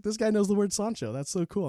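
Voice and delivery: high-pitched, wowed reaction; impressed. Retold or imitated. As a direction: act tickled and impressed. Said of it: "I'm impressed".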